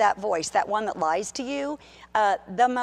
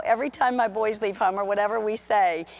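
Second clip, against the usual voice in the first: ordinary talk, nothing marked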